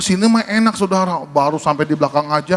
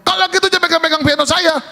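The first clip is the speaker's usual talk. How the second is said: high, fast scolding; galak, fierce; 11 semitones higher, faster than usual. Retold or imitated. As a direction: imitate a territorial pianist telling someone off. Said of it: "galak amat". (bentakan galak, cepat)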